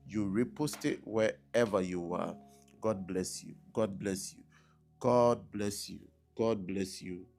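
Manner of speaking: ordinary talk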